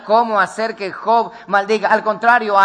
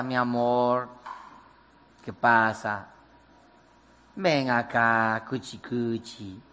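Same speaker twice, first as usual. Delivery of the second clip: low, much softer, slow cooing; sweet-talking wife; 7 semitones lower, 7 dB quieter, slower than usual. Retold or imitated. Wife sweet-talking her husband alone in the bedroom, low soft coo. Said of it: "le habla al oído".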